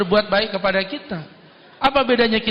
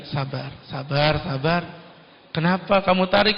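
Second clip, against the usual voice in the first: gentle calming; 4 semitones lower, 3 dB quieter than usual. Acted. The Prophet calms his angry companions softly. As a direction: soothe them down gently.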